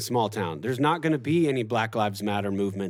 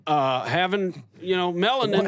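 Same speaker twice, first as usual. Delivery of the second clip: high mock-innocent sarcasm; sarcastic; 10 semitones higher, 4 dB louder than usual. Sarcastic punchline, higher pitch.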